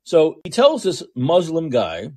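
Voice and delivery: ordinary talk, nothing marked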